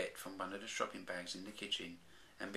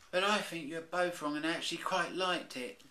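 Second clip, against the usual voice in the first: put-on high childlike little bear; Baby Bear voice; 10 semitones higher, 5 dB louder than usual. Baby Bear disagreeing with his parents, high little-cub voice. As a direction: squeak it out like a small cub.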